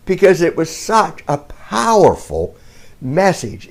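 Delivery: ordinary delivery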